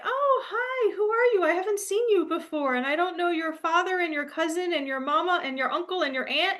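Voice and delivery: high, sing-song nosy country neighbor; elderly couple. Retold or imitated. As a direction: play the curious old folks meeting a stranger. Voicing curious elderly country couple.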